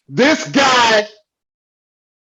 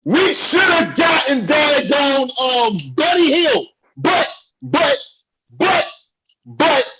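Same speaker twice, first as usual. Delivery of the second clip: heated rant; 7 semitones higher than usual. Real, not acted. Ranting at co-host.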